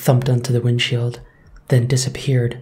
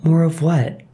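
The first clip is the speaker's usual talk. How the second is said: higher, nervous ask; nervous question; 5 semitones higher than usual. A narrator's reading. Red asking the old woman, mildly nervous. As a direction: ask it with mild nerves. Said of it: "Red asked nervously".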